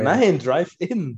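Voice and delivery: slow drawn-out insistence; insistent. Real, not acted. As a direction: stretch it out stubbornly. Arguing, dragged insistence.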